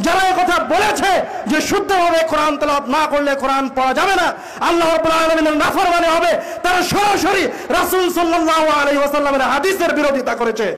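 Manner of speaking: ordinary talk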